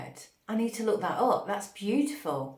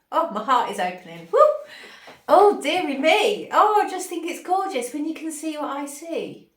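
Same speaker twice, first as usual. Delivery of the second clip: high, much louder, swept away with joy; overjoyed gush; 6 semitones higher, 7 dB louder than usual. Real, not acted. Overwhelmed by channelled vision.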